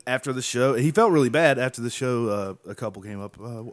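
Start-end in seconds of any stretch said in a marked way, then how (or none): none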